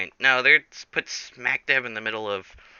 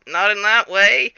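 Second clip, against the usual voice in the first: higher, louder mock protest; protesting retort; 10 semitones higher, 7 dB louder than usual. Jack Cooper corrects the startled audience, mock protesting. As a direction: blurt it as an indignant correction. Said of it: "And he's like".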